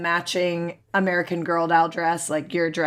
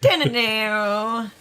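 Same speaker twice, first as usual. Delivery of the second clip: dramatic music sting; 4 semitones higher, much slower than usual. Pretend.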